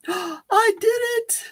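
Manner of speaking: mock-amazed triumph. Real, not acted. Celebrates managing to stop sharing.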